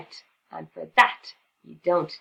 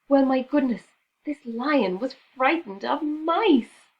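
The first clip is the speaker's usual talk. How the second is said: higher, slow, marvelling; amused amazement; 7 semitones higher, 6 dB louder than usual. A narrator's reading. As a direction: savour the surprise. Narrator marvels.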